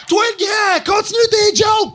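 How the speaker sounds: high, loud shout across the room; shouted order. Retold or imitated. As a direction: yell at the comic to keep going. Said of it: "Elle a encore ça".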